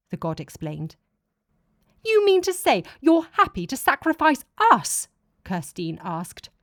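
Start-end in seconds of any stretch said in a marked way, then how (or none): 2.0-5.1 higher and louder, an appalled demand; indignant outcry. Acted. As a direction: throw the accusation at him, stunned and angry. Kirstine outraged at god's plan.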